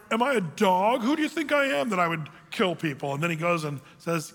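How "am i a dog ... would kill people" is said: higher-pitched offended protest; incredulous, hammed-up denial; mock indignation. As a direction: act affronted, protesting your innocence. Acts out Hazael protesting innocence.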